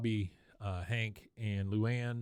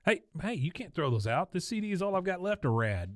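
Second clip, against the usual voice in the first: lightly acted, higher-pitched protest; mock upset; 7 semitones higher than usual. Bobby stopping Luann throwing things out, mildly upset. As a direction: plead a little as an upset boy.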